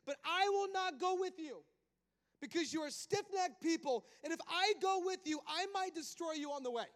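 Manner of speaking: God warning Moses he will not go with him; God refusing. Acted. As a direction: thunder it as God turning away. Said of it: "But watch what he says".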